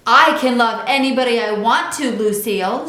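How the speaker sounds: put-on a girl yelling at her rival; hollered. A narrator's reading. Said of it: "she hollered in her face".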